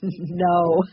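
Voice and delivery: a short laugh leading into the word; laughing reply. A narrator's reading. Joe answering the storekeeper about the children, laughing. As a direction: laugh lightly, then answer. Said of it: "laughed Joe".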